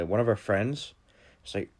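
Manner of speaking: plain reading voice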